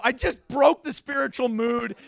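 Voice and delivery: ordinary talk, nothing marked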